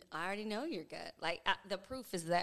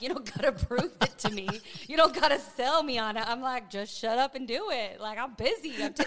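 higher, louder, laughing brush-off; 8 semitones higher, 14 dB louder than usual. Retold or imitated. Husband joking he's too busy to listen, laughing. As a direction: shoo her off while laughing.

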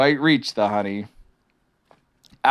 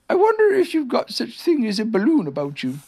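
high-pitched storybook bear voice; Pooh bear, politely curious; 12 semitones higher than usual. Acted.

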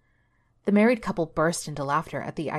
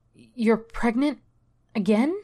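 slow, higher, louder disbelief; shocked; 6 semitones higher, 5 dB louder, slower than usual. Acted. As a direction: gasp it out in disbelief. Shocked neighbor at news.

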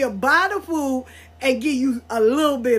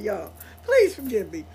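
ordinary talk

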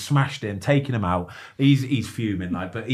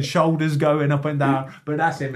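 normal talking voice

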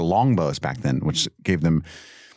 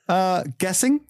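high, uncertain mock reply; sheepish guessing; 10 semitones higher than usual. Hapless lookout answering Robin, sheepishly unsure. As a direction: imitate a hesitant, questioning answer.

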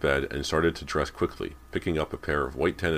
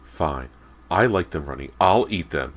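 irritated snarl, getting louder; 2 semitones higher, 7 dB louder than usual. A narrator's reading. Mother taking the plate away, snarling. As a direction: snap it back, louder as you go. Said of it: "Missus Fraser snarled".

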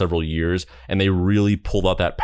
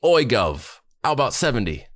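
higher-pitched, mock London accent; 4 semitones higher than usual. London manager haggling with the duo, put-on London accent. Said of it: "He has, like, a London accent".

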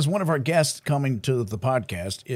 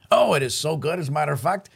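ordinary delivery

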